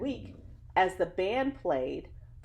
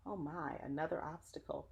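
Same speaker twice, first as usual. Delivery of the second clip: hushed aside; 3 semitones lower, 11 dB quieter than usual. Real, not acted. Reader's soft aside to kids.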